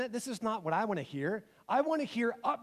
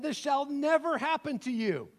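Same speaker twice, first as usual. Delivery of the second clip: higher, mildly acted rebuke; rebuking; 6 semitones higher than usual. Peter refuses what Jesus foretells, mild acted rebuke. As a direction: object firmly, pitch raised. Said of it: "began to rebuke him".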